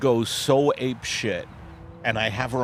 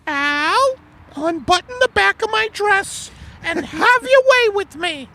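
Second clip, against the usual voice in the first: very high falsetto, coaxing wife; seductive Margaret; 4 dB louder than usual. Acted. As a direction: tease him in a high put-on wife voice. Acted couple skit, seductive wife.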